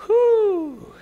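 loud, drawn-out high whew; exaggerated high whoop of relief. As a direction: exhale a big mock sigh of relief. Relief after listing commandments.

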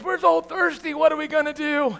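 high whiny voice of the thirsty crowd; whiny complaining crowd. Retold or imitated. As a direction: whine it like a desperate crowd. Israelites whining about thirst again.

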